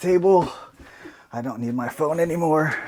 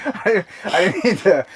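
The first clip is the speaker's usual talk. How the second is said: laughing; 9 semitones higher than usual. Real, not acted. Laughing apology to dad.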